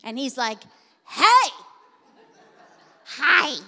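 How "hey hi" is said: put-on high, loud little boy's "Hey!"; toddler's shout. Mother imitating her son yelling hey down the aisle. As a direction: call out like an excited toddler. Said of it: "My son yelled at her".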